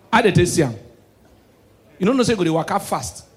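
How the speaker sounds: normal talking voice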